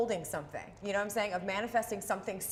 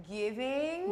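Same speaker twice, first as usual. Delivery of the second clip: GIVING stretched out long, pitch climbing; teasing slow-motion delivery of GIVING; exaggeratedly slow; 5 semitones higher than usual. Shown as a demonstration. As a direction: draw out GIVING as if for a slow listener. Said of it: "Can you say it slower?".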